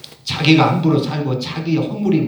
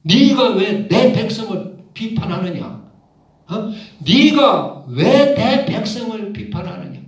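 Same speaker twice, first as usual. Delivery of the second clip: God rebuking; 7 semitones higher than usual. Retold or imitated. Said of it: "하나님이 기분이 싹 상했어요".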